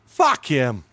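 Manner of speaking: defiant retort. Retold or imitated. Kerry told to shut his mouth, defiant mock yell. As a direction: shout it dismissively, imitating a friend. Friend's defiant reply, acted.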